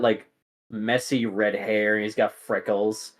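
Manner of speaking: ordinary delivery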